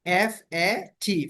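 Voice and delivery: each letter drawn out slowly; slow spelling. Shown as a demonstration. Teacher spelling fat slowly for students. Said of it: "teacher spells letters for class".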